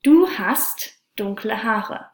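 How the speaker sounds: normal talking voice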